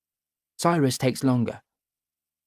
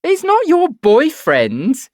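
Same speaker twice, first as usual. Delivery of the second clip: high, loud sing-song tease, drawn out; mocking taunt; 9 dB louder, much slower than usual. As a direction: tease her like a spiteful schoolgirl. Davina taunts Evie mockingly.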